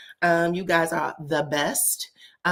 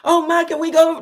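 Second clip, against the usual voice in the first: put-on begging child; 9 semitones higher, 7 dB louder than usual. Kids begging their mother.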